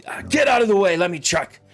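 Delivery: high, pushy guard barking orders; gruff enforcer voice. Acted. Enforcer shoving a guard aside, barking. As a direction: shove the other guard aside.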